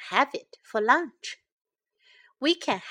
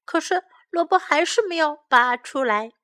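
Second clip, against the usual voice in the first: ordinary delivery, nothing marked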